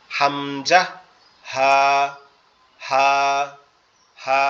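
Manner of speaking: Arabic ha with a push; pushed throat sounds. Shown as a demonstration. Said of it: "একটু loud আওয়াজে".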